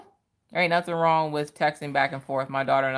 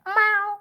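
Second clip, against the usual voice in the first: much higher little mock meow; cat meow; 4 dB louder than usual. Viewer's sprayed cat story, a much higher mock meow. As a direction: squeak out a tiny kitty meow.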